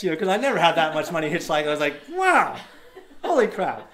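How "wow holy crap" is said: high, loud wow, easing down after; hammed-up astonishment; retold amazement. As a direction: marvel at it in comic awe. Retells amazement at the money.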